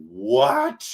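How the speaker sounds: high startled what; surprised. Real, not acted. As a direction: react with disbelief. Shocked by chat trade rumour.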